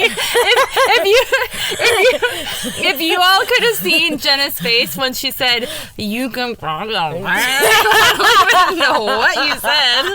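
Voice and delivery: words broken by real laughter; struggling to speak through giggles; laughing while talking. Real, not acted. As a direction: try to talk through a laughing fit.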